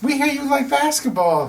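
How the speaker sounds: high-pitched mock sales pitch; eager sales caller. Team rep calling a lapsed ticket holder, high and eager. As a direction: put on a high telemarketer voice.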